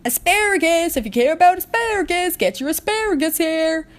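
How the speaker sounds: high, loud vendor hawking asparagus; market shouting. A narrator's reading. Market pony hawking asparagus, loud high vendor shout. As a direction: bellow your wares across the market. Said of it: "Ponies were shouting".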